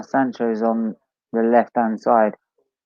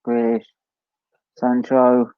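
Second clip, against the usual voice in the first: ordinary talk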